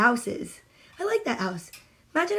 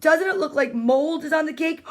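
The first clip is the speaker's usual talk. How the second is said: ordinary delivery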